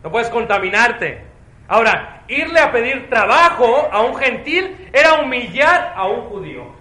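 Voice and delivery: loud, high, forceful preaching; żarliwe kazanie. Real, not acted. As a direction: drive each phrase home to the congregation.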